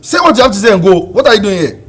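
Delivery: loud, high impatient yelling; angry shouting. Acted. Man yelling at Bella to say it and leave, angry. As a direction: shout at her to just say it.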